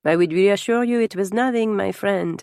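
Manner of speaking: put-on teasing French-accented reassurance; amused French nobleman. French guest teases jealous husband, amused French accent.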